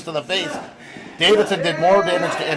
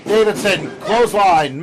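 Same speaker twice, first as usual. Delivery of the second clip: ordinary delivery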